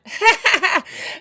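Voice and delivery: loud high burst of laughter. Retold or imitated. Retelling meeting Joan, bursts into loud high laughter. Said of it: "when I started laughing".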